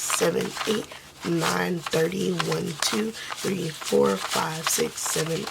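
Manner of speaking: ordinary delivery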